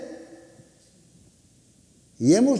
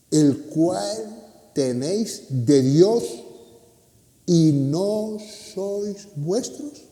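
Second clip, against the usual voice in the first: slow, measured reading of the verse; solemn scripture reading; 5 semitones lower, slower than usual. Acted. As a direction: read the verse slowly and gravely, word by word. Reads the Bible verse aloud. (lectura lenta y solemne del versículo)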